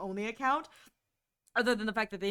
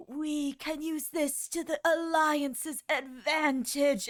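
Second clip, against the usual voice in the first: slow, strained, as if in pain; weary dying drawl; mock weary heroine, slow; pained character voice; 5 semitones higher, much slower than usual. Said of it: "she's like".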